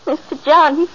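breaking into a sob over his body; sobbing in shock at finding him dead; crying. Acted. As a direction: cry out his name through tears. Housekeeper finds master dead.

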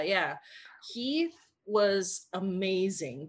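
ordinary delivery

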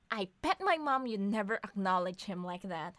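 ordinary talk

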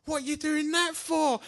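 high-pitched mock bewildered friend; high, put-on voice of a puzzled pal; baffled mate. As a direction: imitate a mate's incredulous question, pitched right up. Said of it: "he was going".

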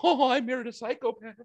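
high-pitched retold wail of realization; high mock lament; mock dismayed wife. Wife's retold dismayed reaction.

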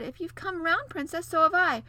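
high, loud and eager prince; excited prince. A narrator's reading. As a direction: call out with delight. Prince voiced in story.

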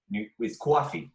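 coffee said as kwawfee, a New York accent. Shown as a demonstration. Explaining New York talk, says coffee in a New York accent. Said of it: "Those were the two that I would sometimes do".

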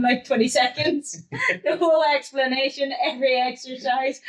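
ordinary talk, nothing marked

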